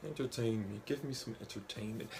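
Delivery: sit back and say it quietly, seriously; serious comics Grandmaster. Acted. Comics Grandmaster idly demanding amusement, quiet and serious. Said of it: "just a really serious person".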